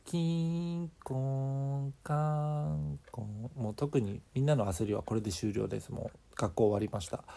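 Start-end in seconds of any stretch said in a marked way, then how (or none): 0.1-3.6 slow sung bell tones; imitated school chime. Reader imitates the school bell, slow and sing-song.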